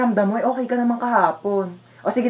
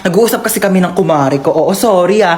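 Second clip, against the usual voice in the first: put-on louder, annoyed kid; loud complaint; 7 dB louder than usual. Kid complaining to noisy mom, loud. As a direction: complain over the noise.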